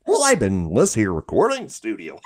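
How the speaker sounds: swooping cartoon goofball voice; Goofy. Stuck in a cartoon voice after studio week, goofy and swooping. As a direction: repeat it as a hammed-up cartoon goof. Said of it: "you get stuck like that".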